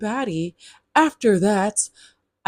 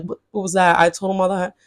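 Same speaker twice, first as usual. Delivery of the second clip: usual speaking voice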